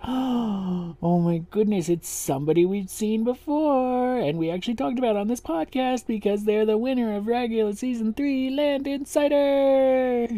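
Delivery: rising giddy excitement, slowing on the name; gasp, then build up to the name; excited. Real, not acted. Excited reveal of the next guest judge.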